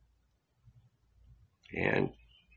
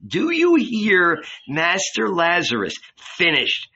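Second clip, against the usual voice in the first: forceful, raised threatening voice; stern apostle; 6 semitones higher than usual. Acted. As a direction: warn him off, hard and high.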